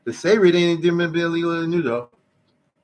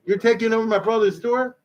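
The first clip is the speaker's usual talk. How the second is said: indignant wife; 8 semitones higher than usual. Retold or imitated. Wife confronts brother-in-law.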